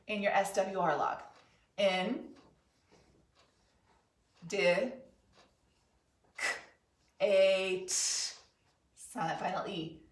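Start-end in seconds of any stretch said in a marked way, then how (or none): 1.8-7.9 "In, D, K, A, T" with long pauses between pieces; sounding out while writing. Shown as a demonstration. Teacher writing as the class follows, sounding out slowly. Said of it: "sound it as you're writing it".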